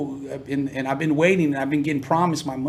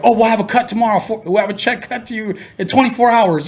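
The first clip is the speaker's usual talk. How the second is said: higher, louder, hurried reassurance; eager to please; 7 semitones higher, 5 dB louder than usual. Retold or imitated. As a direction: suck up to him, pitched higher and louder. Attorney suddenly accommodating.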